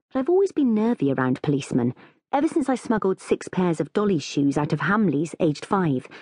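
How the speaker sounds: usual speaking voice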